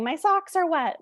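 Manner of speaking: usual speaking voice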